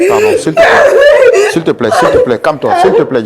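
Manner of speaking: high, pressing plea with a nervous laugh; loud pleading. Real, not acted. Host begging Jamila loudly to calm herself. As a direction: beg her loudly to calm.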